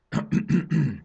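discreet announcing cough; deliberate little "ahem"; throat clearing. Acted. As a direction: clear the throat to get noticed. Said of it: "köhintett a főstrapa".

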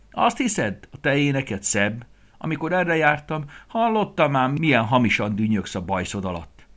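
high, loud mocking rabbit; cheeky taunt. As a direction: tease the grumpy farmer.

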